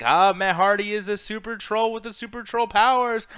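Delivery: high, loud gleeful fan voice; mock-thrilled fan cheering on the troll; excited imagined reaction. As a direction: gush like a delighted fan. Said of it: "It's like".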